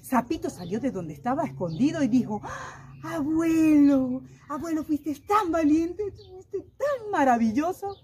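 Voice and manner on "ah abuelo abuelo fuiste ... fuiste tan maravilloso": high, slowed, admiring gasp and praise; little frog gushing over his grandpa; excited little frog. Acted. Little frog cheers his grandpa, high and excited.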